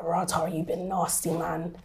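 quiet self-scolding realising she was cruel; hushed self-reproach. Retold or imitated. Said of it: "And I was like".

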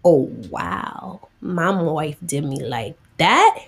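ordinary talk

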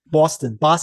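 mock Boston accent, dropped r; lightly imitating a Boston local; playful Boston accent. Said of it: "Unless you're from Boston".

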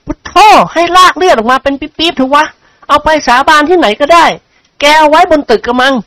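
high, exasperated protest; oath-swearing friend character. Acted. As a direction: play an accused pal insisting.

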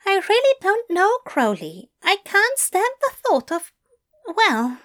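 high, fretful fussy voice; anxious angel voice. A narrator's reading. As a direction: fret in a high voice.

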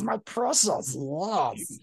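creepy creature croon, hoarse and fawning; Gollum voice. Acted. Showing off his favourite voice to cohost, Gollum croon. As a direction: purr it like a creature clutching a treasure. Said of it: "say anything in a Gollum voice".

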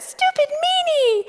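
put-on tiny squeaky two-year-old, drawn out; super high-pitched toddler. Two-year-old daughter scolding her mom, super high-pitched voice. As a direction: squeak it way up high like a little girl. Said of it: "like that high".

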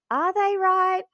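high, anxious second-guessing voice; doubting inner voice. Retold or imitated. The doubting inner voice asking herself, high and anxious. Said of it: "that little human side of you is like".

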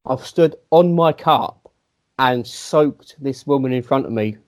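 normal talking voice

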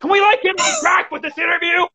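high-pitched exasperated PR guy impression; mock indignant yelling. PR guy interrupts the interview, mock indignant yelling. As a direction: imitate a fed-up handler butting in, voice way up high. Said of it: "came on and started yelling and said".

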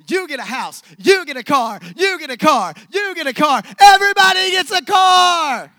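hammed-up giveaway hype; Oprah giveaway. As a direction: yell out the prizes to the crowd. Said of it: "Where my Oprah fans".

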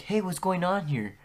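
high-pitched teacher's query; imitated teacher. Teacher finding her student outside, high imitated query.

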